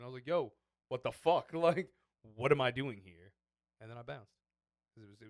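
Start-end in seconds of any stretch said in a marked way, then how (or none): 0.3-3.3 loud, laughing mock outrage; acted-out, raised-voice bewilderment; retold exasperation. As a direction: exclaim it in loud disbelief.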